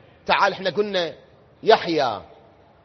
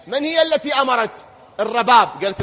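usual speaking voice

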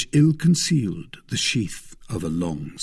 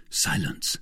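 unvoiced whisper of warning; hushed warning; 4 semitones lower, 5 dB quieter than usual. A narrator's reading. D'Artagnan hushing Madame Bonacieux, whispered. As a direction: shush her in a whisper. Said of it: "said D'Artagnan".

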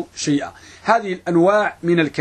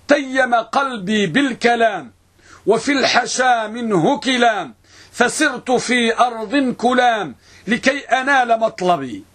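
slow, chant-like poem recitation, pitched higher; higher, drawn-out reciting voice; verse recitation; 4 semitones higher, slower than usual. Real, not acted. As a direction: intone each line with long-held vowels.

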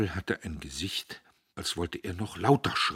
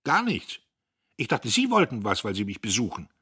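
put-on young man's voice, raised and curt; Diederich, gereizt; 5 semitones higher, 9 dB louder than usual. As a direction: snap at the visitor, higher and louder. Diederich spricht Göppel an. (Diederich gereizt und laut)

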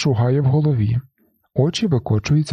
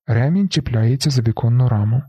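ordinary delivery, nothing marked

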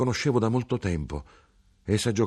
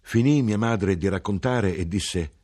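ordinary delivery

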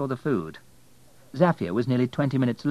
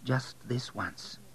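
slow, soft repetition; soft, drawn-out, wondering repeat; hesitant echo; 3 semitones higher, 10 dB quieter, much slower than usual. A narrator's reading. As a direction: echo it slowly and much more softly. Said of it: "she repeated".

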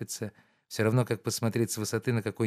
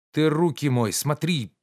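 sharp, louder angry scolding; 5 semitones higher, 5 dB louder than usual. Acted. Master scolds his servant about dirty hands, angrily. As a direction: snap it out in annoyance. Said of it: "злобно сказал Обломов".

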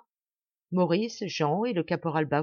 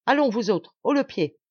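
raised, louder command; giving orders; 5 semitones higher, 5 dB louder than usual. A narrator's reading. Sergeant addressing his men, commanding. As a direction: rouse the men to move.

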